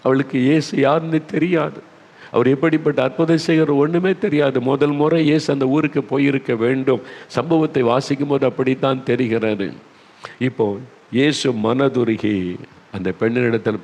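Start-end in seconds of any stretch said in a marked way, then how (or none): none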